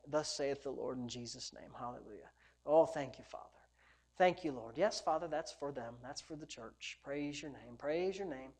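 very soft, low thanks; hushed prayer. Real, not acted. Preacher thanks the Father after prophesying, hushed low prayer. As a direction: drop to a private murmur of devotion.

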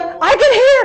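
very high imitated cry of wonder; overjoyed cry. Girl repeating that she can hear, overjoyed. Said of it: "She just kept saying it".

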